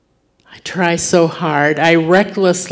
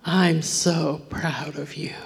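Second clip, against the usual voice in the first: soft unvoiced whisper; whispered praise; 6 dB quieter than usual. God to his daughter, softly whispered. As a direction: whisper it like a loving father.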